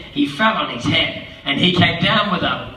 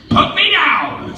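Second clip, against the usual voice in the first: much higher, slow squeaky plea; pleading fish; 11 semitones higher, 4 dB louder, slower than usual. Acted. The fish begs the cat, high and slow. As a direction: beg to be put down.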